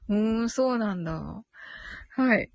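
ordinary delivery

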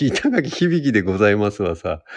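laughing into a put-on character voice; mock self-introduction. Host jokingly attempting a self-introduction, laughing put-on voice. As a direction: introduce yourself in a hammed-up character, amused. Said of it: "自己紹介が".

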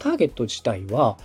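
usual speaking voice

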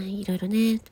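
usual speaking voice

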